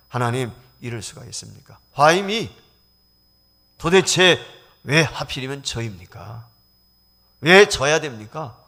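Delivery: loud, slow, rising lament; bitter complaint to God. Retold or imitated. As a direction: cry out at God in resentment. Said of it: "원망을 했다는 거예요". (원망하며 외치듯)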